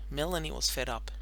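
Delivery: usual speaking voice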